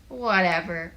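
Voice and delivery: dismissive grumble; loud, put-out grumble; grumbled. A narrator's reading. Asha brushes off a classmate, grumbling. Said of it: "Asha grumbled".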